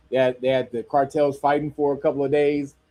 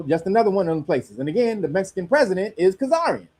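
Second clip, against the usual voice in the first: normal talking voice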